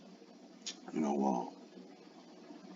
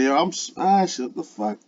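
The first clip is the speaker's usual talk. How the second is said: mimicking a hungry dude; 8 semitones higher than usual. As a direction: play the guy eagerly ready to eat. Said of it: "He said".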